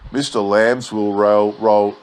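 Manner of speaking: usual speaking voice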